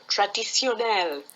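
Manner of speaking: put-on French accent, stressed on the last syllable. Helper gives the French word to a stuck speaker, French accent. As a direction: say it with French pronunciation. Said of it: "say it in a French accent".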